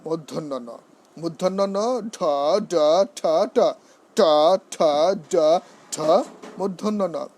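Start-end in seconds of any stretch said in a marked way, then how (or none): 1.2-6.0 retroflex sounds, each separately and firmly; practice drill of sounds. Shown as a demonstration. Teacher drilling letters for practice, firm separate sounds. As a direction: pronounce each letter as its own beat. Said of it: "This is how we have to practice".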